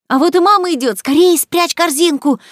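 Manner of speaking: high, loud cry of alert; urgent cry. A narrator's reading. Jo warning her sisters that mother is coming, crying out. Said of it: "закричала Джо".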